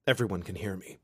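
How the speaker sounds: ordinary delivery, nothing marked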